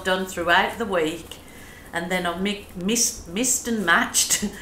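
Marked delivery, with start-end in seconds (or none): none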